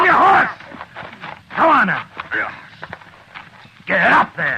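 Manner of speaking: loud, high commanding shout; forceful yelled command; barked order. Acted. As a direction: drive him onto the horse. Marshal orders prisoner mount.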